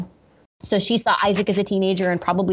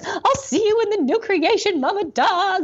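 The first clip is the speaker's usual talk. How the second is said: much higher, tearful goodbye to the dying dog; sobbing farewell; 12 semitones higher than usual. Retold or imitated. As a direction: cry it out as you say goodbye to Mama Dog. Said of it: "I'm sobbing and I'm like".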